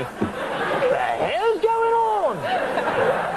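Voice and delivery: very high, drawn-out mock Cockney outburst; hammed-up British cab driver's yelp; alarmed British cabbie. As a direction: squawk it like a baffled Cockney cabbie. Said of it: "twenty minutes into the cab ride".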